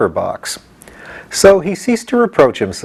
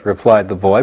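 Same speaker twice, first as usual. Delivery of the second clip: usual speaking voice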